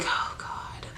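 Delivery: breathy whispered "oh God", under her breath; whispered exasperation. Retold or imitated. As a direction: sigh it out in a whisper. Exasperated aside to herself.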